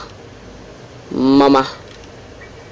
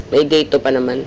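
ordinary delivery

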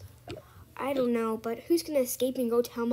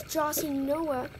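ordinary delivery

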